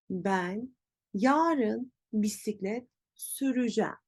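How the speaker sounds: süreceğim as sü-rü-jem; spoken contraction. Shown as a demonstration. Teacher repeats for learners, süreceğim as sürücem. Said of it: "böyle değil".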